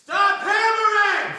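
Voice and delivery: high, drawn-out shout; real yell. Real, not acted. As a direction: yell slowly through the wall at the noise. Yelling at hammering upstairs.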